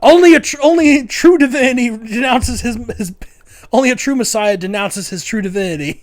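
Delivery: zealous follower. Retold or imitated. Follower proclaiming Brian divine, in a high zealous voice. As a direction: declare it like holy doctrine.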